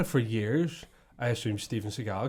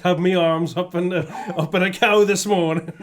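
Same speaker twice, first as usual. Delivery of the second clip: loud, higher, laughing through it; laughing farmer quote; 5 semitones higher, 9 dB louder than usual. Retold or imitated. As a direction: quote the farmer while cracking up. Voicing the farmer character.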